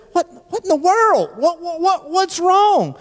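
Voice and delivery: panicked what's-wrong; alarmed husband. Retold or imitated. Husband startled by his weeping wife, high and alarmed.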